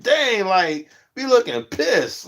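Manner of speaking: high pleading call-out; exasperated scolding. Retold or imitated. Vlogger telling scowling streamers to smile, high and exasperated. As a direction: beg them to lighten up. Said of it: "I'm like".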